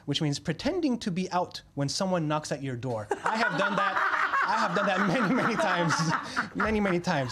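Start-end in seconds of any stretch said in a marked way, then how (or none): 3.2-7.3 laughing through the words; giggling while confessing. Real, not acted. Laughing about pretending not home.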